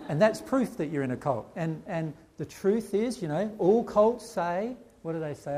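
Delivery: ordinary delivery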